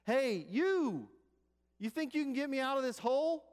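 high, loud shout for help; yelling from a hole. Retold or imitated. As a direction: yell up to a passerby, stretched and high. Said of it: "he yells at him".

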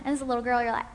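usual speaking voice

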